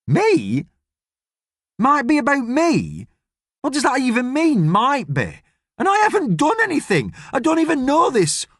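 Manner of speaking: put-on high, protesting girl's voice; a stung teenager refusing the accusation, pitched high; Ellie, indignant disbelief.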